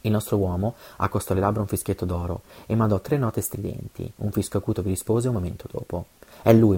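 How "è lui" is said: louder burst of mild excitement; lively emotion. A narrator's reading. Sandokan sees his awaited friend arrive, with lively emotion. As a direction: let emotion jump out on a short, loud line. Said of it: "mormorò con viva emozione".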